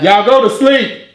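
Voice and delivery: put-on loud, slow dad shout at kids; dad yelling. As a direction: bellow it like a stern dad. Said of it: "Good dads be like".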